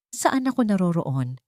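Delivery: ordinary delivery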